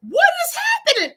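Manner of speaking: high, loud recalled disbelief; retold alarm. Retelling her reaction to sick sister, high alarm. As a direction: cry it out high, reliving the shock.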